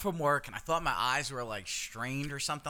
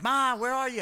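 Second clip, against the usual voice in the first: much higher, louder call; 12 semitones higher, 6 dB louder than usual. Retold or imitated. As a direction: call upstairs for mom. Calling upstairs.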